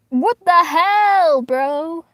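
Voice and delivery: loud, high, drawn-out disbelief; appalled cry; shocked outburst. Real, not acted. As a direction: stretch it out in loud indignant shock. Startled reaction while reading.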